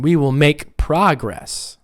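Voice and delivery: slow model sentence, "progress" as PROgress; first-syllable stress. Shown as a demonstration. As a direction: hit PRO in "progress". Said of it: "nouns on the first syllable".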